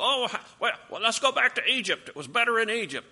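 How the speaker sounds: high-pitched grumbling, imitated; mock complaining. Preacher imitates the people grumbling to Moses, mock complaining. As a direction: whine like a discontented crowd, hammed up. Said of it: "They complained, they moaned, they groaned, they murmured".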